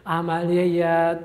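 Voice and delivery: عملهٔ drawn out very slowly syllable by syllable; slow spelled-out word. Shown as a demonstration. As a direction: say عملهٔ slowly so pupils catch it. Teacher repeats the term slowly. (کلمهٔ عملهٔ را خیلی آهسته و کشیده بگو)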